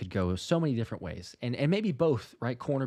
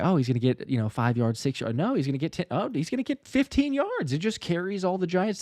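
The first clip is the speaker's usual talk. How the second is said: rising higher with each new guess; mounting excitement; 8 semitones higher than usual. Acted. Fan watching Tank's run gain more yards, mounting excitement. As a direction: build from eager guesses to a high amazed cry.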